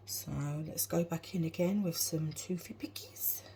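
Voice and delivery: soft unvoiced whisper. Real, not acted. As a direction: whisper it playfully. Suddenly whispers at the food.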